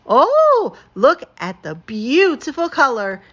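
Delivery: squeals high on "Oh" and "exciting", stretching the last word slowly; can barely contain the excitement; giddy, gushing squeal; overexcited gush. Acted. Skunk thrilled by egg dye.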